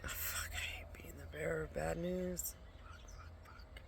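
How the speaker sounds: cursing in a whisper to herself; whispered curse about bearing bad news; exasperated whisper. Real, not acted. Cursing under breath, exhausted.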